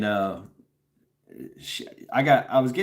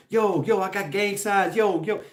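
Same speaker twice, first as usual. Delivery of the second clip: higher, hyped-up mock gangster girl; mock gang girl; 7 semitones higher than usual. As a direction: show off gang signs like a tough street girl. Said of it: "she's throwing up gang signs".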